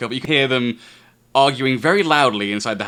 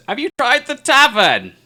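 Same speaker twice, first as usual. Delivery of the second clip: put-on higher, louder old lady voice; old woman suggesting; 12 semitones higher, 8 dB louder than usual. Old woman suggesting the tavern to the traveller, raised voice. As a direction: offer it as helpful advice.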